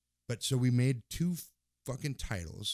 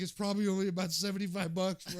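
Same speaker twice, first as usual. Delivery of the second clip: high, loud surfer-bro calm; mock laid-back reassurance; 10 semitones higher, 4 dB louder than usual. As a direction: tell your buddy to chill. Retold reassuring self.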